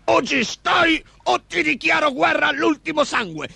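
high ogre war threat; snarling threat. A narrator's reading. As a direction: threaten menacingly in a high creature voice. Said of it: "ringhiò l'altro agitando minacciosamente". (orco che ringhia minaccioso, acuto)

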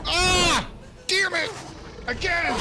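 high, loud yell of frustration; frustrated scream. Real, not acted. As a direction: scream it out, caught again. Caught by alien again.

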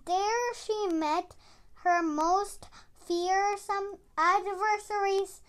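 ordinary delivery, nothing marked

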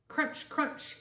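breathy whispered crunch, crunch. Acted. Grandma whispers the snow sound to grandchildren.

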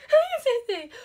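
high, loud delighted squeal; thrilled squeal. Real, not acted. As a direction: squeal at getting it right. Got answer right. (gritinho agudo e alto de alegria)

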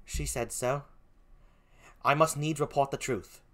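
put-on soft, poor British accent; subdued British-accented concession; Emilia. Gentlewoman reports the truth, softly in a British accent. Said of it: "please forgive my poor British accent".

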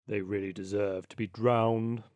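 put-on low, indignant old rat; old water rat. Old water rat condemning the ducklings, low indignant voice. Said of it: "cried the old water rat".

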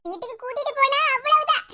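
ordinary talk, nothing marked

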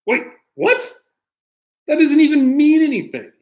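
high, squeaky what in fake disbelief; mock surprise. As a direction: play baffled for the kids. Mock confusion at book.

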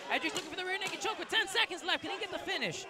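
rising excited call. Real, not acted. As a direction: call the finish attempt urgently. Commentator excited near the finish.

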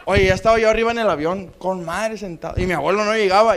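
ordinary talk, nothing marked

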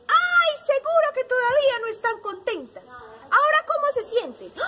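high, lively storybook bee; little bee. A narrator's reading. Little bee questioning the swapped animals, piping. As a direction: pipe up like a tiny bee checking in. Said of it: "esta amiguita abejita y les dijo".

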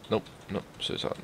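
usual speaking voice

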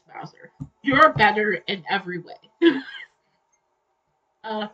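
ordinary talk

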